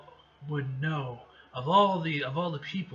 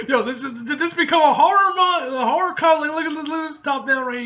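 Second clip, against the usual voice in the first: loud, high, fast excitement; excited; 11 semitones higher, 11 dB louder, faster than usual. Real, not acted. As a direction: hype it up, laughing. Reader excited at comic panel.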